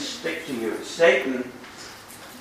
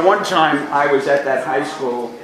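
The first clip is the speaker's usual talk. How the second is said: ordinary delivery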